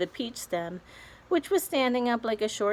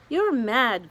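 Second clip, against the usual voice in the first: cried; 4 semitones higher, 5 dB louder than usual. A narrator's reading. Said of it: "cried the earthworm".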